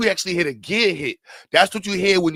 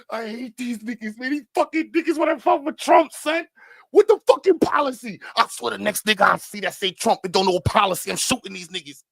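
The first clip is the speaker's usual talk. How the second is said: crazed crying rant; 6 semitones higher than usual. Acted. A drunk man crying with a gun in the car, very high unhinged wail. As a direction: wail it like you've snapped.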